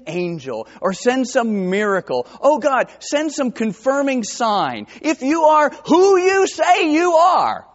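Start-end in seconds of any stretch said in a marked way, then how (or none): 2.4-7.7 high, loud, slow pleading demand; demanding prayer. Retold or imitated. Doubter praying to God for a sign, demanding. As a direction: challenge God to prove himself. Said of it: "This is kinda how we talk".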